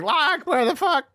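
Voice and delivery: high, baffled call; mock-shouting into the jungle; imitating a confused Sawyer. Sawyer voiced calling out.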